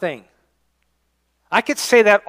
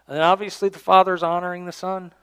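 ordinary talk, nothing marked

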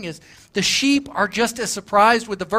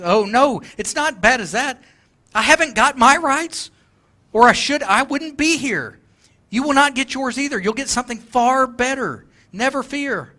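higher, kindly coaxing voice; former employee; 6 semitones higher, 3 dB louder than usual. Acted. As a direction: gently reassure the bitter man, pitched higher.

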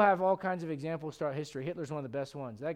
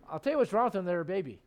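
ordinary talk, nothing marked

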